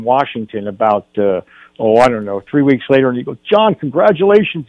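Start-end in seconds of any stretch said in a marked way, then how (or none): 3.5-4.7 high, excited retold congratulations; mock excited scream. Cousin phoning the actor with big news, mock excited scream. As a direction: imitate an elated caller, pitched up. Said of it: "He's screaming".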